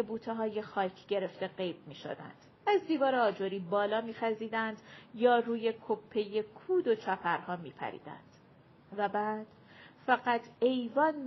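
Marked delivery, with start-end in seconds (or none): none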